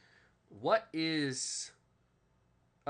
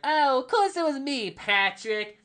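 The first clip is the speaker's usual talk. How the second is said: much higher, loud cartoon voice; Patrick Star voice; 13 semitones higher, 12 dB louder, slower than usual. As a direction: play the dopey cartoon starfish, loud and high. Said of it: "I used to sound like Patrick Star".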